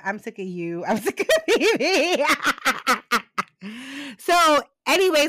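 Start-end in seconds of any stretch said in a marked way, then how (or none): 0.8-2.3 laughing through the words, slowed down. Real, not acted. Co-host fed up with Phoebe, laughing while repeating it. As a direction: giggle the line out.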